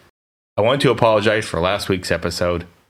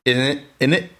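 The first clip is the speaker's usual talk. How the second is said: hammed-up, higher-pitched mock British accent; bad British accent; 5 semitones higher, 3 dB louder than usual. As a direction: poke fun with a badly faked British accent. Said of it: "I can't do accents to save my life".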